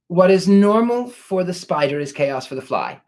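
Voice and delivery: ordinary talk, nothing marked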